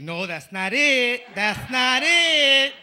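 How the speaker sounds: high, loud, slow "that's not it"; drawn-out girlfriend protest. Retold or imitated. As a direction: stretch it out like an annoyed girlfriend. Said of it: "your girl's like".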